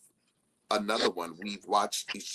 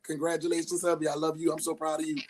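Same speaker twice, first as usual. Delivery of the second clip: imitating her friend; 8 semitones higher, faster than usual. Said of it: "she'll call and be like".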